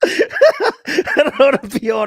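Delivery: slow, high, laughing through the words; giggling while speaking. Real, not acted. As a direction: laugh it out slowly.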